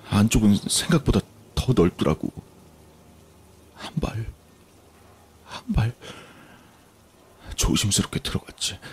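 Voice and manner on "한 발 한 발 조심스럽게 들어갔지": soft, breathy creeping narration; hushed, cautious. Acted. Telling friends of creeping into an abandoned house, hushed and cautious. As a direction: step carefully through the words, half whispered.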